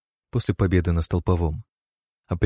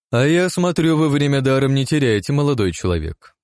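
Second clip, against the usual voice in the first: higher, louder mocking jibe; 8 semitones higher, 4 dB louder than usual. A narrator's reading. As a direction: needle him with a sneer. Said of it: "раздался насмешливый голос".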